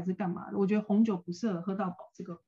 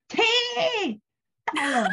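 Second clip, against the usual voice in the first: a sudden high yelp on stop, then lightly voiced; sudden drunk command; 12 semitones higher, 3 dB louder, much slower than usual. To the Uber driver, imitating the drunk friend's high sudden stop call.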